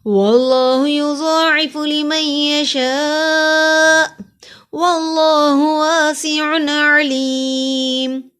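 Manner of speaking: slow, melodic chanted recitation; Quran recitation. Shown as a demonstration. Teacher reciting the whole verse fluently for students. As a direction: chant the verse slowly and evenly. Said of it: "इस आयत को रवानगी से पढ़ते हैं".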